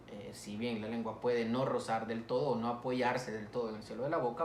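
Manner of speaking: normal talking voice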